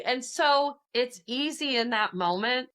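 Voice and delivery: ordinary talk, nothing marked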